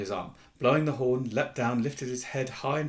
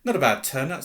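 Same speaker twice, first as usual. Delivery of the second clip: louder, self-satisfied remark; proud; 2 semitones higher, 8 dB louder than usual. A narrator's reading. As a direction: announce it with host's pride. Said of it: "said Nearly Headless Nick proudly".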